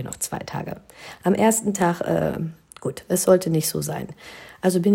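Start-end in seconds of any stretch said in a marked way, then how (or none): 0.0-0.8 "Ich hab ja noch zwei Tage" whispered to herself; consoling whisper. Shown as a demonstration. Bride comforting herself about the buffet, whispering. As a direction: breathe it as quiet self-comfort. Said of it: "Ich hab ja noch zwei Tage".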